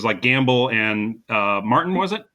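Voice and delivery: ordinary talk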